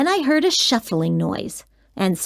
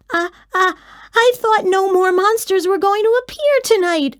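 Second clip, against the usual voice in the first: put-on startled child; 9 semitones higher than usual. A narrator's reading.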